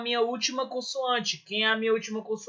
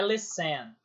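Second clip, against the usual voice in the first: "listen" with the weight on the last syllable; wrong stress on TEN; 4 semitones lower, 6 dB quieter than usual. Shown as a demonstration. Teacher repeating the mistaken stress of listen, end-stressed. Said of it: "é o les e não o ten".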